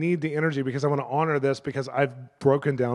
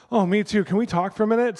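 higher-pitched, keen request; imitated eager captain; 7 semitones higher, 4 dB louder than usual. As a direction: lightly act out the captain chiming in. Voices the captain asking for prayer.

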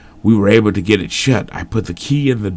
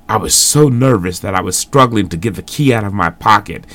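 ordinary delivery

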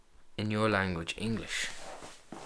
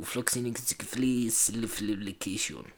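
softer, garbled French imitation; mock French accent; 9 dB quieter than usual. Man copying a French pronunciation clip, garbled mock French accent. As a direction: stumble through it in a rough French accent.

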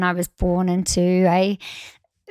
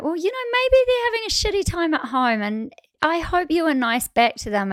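put-on much higher, quick gentle lecture to kids; motherly advice; 8 semitones higher, faster than usual. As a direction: coax the kids quickly, voice up high. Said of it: "And I'm like".

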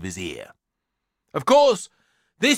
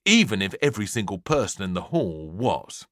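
usual speaking voice

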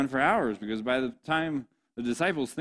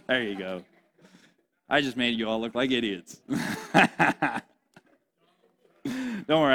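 ordinary delivery

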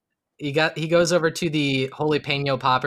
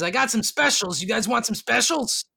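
pitched-up hawker offering specials; salesy barkeep; 7 semitones higher than usual. Acted. Barkeep tempting the party with specials, pitched up. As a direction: sell the specials like a street vendor.

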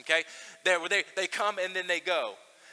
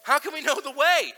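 rising, louder puzzled question; Thomas doubting; 6 semitones higher, 6 dB louder than usual. Retold or imitated. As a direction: ask it like a confused disciple, pitch climbing. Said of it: "he says".

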